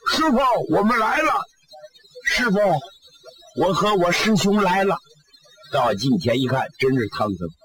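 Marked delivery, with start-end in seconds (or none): 0.1-5.0 higher, fast, eager greeting; eager call. Acted. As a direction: rush to the master with relief. Disciple eagerly finds master.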